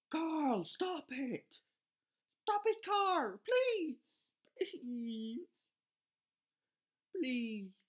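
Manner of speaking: high, loud begging cry; frantic shouting plea; desperate pleading. Real, not acted. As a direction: beg the troop to stop, in real panic. Pleading with a game troop.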